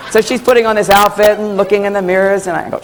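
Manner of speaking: usual speaking voice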